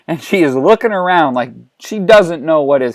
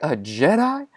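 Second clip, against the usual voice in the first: softer rising question; disbelieving echo; 5 dB quieter than usual. Acted. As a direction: echo it quietly, as if not believing it. First echo before an outburst.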